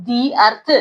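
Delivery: "the" said "dee" before अर्थ; long ee in "the", then अर्थ. Shown as a demonstration. Teacher answers her own question, "the" as dee. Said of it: "इसको क्या पढ़ूंगी मैं?".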